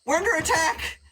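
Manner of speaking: high-pitched call to arms; panicked alarm. Acted. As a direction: raise the alarm across the camp. GM voices camp raising alarm.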